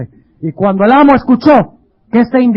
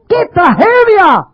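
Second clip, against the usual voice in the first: "tragedia" drawn out, higher and slow; slower higher lament; 9 semitones higher, slower than usual. Acted. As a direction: stretch the grief further. ("tragedia" lenta y más aguda)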